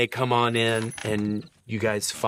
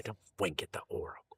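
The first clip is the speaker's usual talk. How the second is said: softer, fast scolding under the breath; scolding hush; 3 semitones lower, 11 dB quieter, faster than usual. Acted. Old wife to husband about the oracle, hushed. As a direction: hiss a quick reproach. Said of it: "Mama says".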